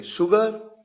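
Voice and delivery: "शुगर" with sh as in shalgam; teacher models "शुगर" starting on sh; clearly hushed first sound in "शुगर"; sh despite spelling. Shown as a demonstration. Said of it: "उच्चारण में शलगम वाला श है".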